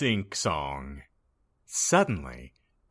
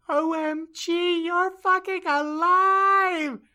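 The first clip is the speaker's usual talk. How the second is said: excited shriek; 7 dB louder than usual. Acted.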